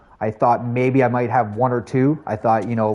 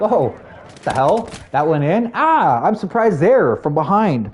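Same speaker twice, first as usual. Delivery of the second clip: mock-surprised, higher and slow; 5 semitones higher, slower than usual. Streamer acting out his own lucky goal, mock surprise. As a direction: replay the shock of a lucky goal, a bit hammed-up.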